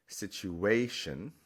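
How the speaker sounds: situation drawn out with the weight on A, sit-u-A-tion; stress on the A. Shown as a demonstration. Teacher shows natural English stress, stress on A. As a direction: slowly lean on the A of situation. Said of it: "the natural stress is on that A".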